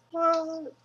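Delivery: high squeaky What?; mock surprise. Vlogger reacting to his own shout-out with squeaky surprise. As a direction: jump way up in fake shock.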